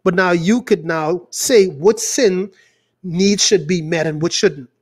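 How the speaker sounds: ordinary talk, nothing marked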